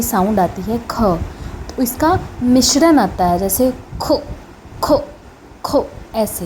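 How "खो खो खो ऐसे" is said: "खो" said as a k sound with a breath of kh mixed in; k with a breathy kh. Shown as a demonstration. Teacher demonstrates "खो" for students, aspirated k. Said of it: "इसको हम ऐसे pronounce करते हैं".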